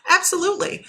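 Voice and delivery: a sudden high burst of agreement; eager high-pitched absolutely; emphatic yes. Real, not acted. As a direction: pop the word up high, fully convinced. Answering a viewer's question.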